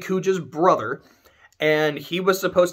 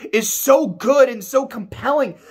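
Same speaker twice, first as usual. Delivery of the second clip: ordinary talk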